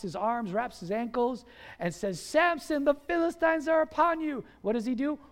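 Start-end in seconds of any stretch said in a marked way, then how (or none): 2.2-4.4 higher-pitched, urgent call; urgent warning cry. Acted. Delilah warns sleeping Samson of the Philistines, urgent warning cry. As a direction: raise the pitch and sound the alarm.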